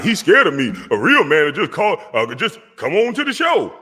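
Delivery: lightly mocking voice of the rival; taunting him by quoting his boast; mocking quote. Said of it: "Telling everybody".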